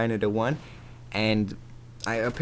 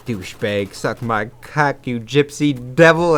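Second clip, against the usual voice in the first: slow insults that build to a loud yell; retold telling-off of the insurance man, hammed up; mock cussing out; 7 dB louder, slower than usual. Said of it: "And I was like".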